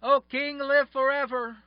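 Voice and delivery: steady, reassuring cry upward; yelling up. Acted. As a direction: shout up to the king from the pit. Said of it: "Daniel yells out from the bottom".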